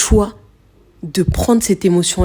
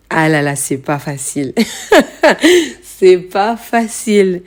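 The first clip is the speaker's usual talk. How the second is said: rueful laugh. Real, not acted. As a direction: laugh at how hard it is. Sighs about how hard it is. (rire désabusé, plus lent)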